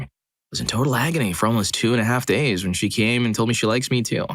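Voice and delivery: ordinary delivery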